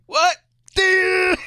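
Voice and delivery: high startled yelp; shocked. Retold or imitated. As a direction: squeal it in shock. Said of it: "I was like".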